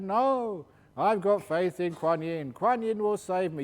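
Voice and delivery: stubborn refusal. Retold or imitated. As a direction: dig in and refuse, pitch up. Stubborn monk refusing rescue.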